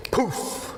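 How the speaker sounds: high breathy poof; magic poof. Retold or imitated. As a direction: puff it out like a magic spell. Magic effect in genie story.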